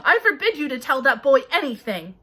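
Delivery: loud, high, pompous orders; blustering uncle. A narrator's reading. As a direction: forbid it with bluster. Vernon voiced commanding.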